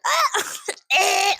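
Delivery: sudden high cackle; high burst of laughter. Real, not acted. Laughing at her own line about her mom.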